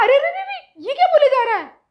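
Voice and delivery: very high, loud outcry; shocked exclamation. Retold or imitated. Mother hears son garble the prayer, loud shocked outcry. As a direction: throw up your hands in horror. Mother cuts off son's wrong recital.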